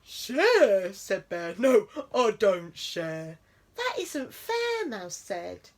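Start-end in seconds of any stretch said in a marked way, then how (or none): 0.0-0.9 bear scoff. A narrator's reading. 1.6-3.4 slow, louder bear refusal; stubborn bear. A narrator's reading. 3.7-4.9 much higher slow squeak of protest; indignant mouse. A narrator's reading.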